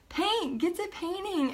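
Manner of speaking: imitated urging in a high pitch; high, pressing mock command to get started. Husband's order retold again.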